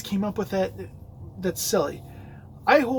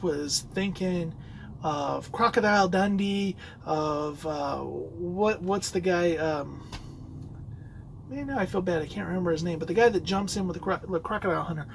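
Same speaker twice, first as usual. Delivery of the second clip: ordinary talk, nothing marked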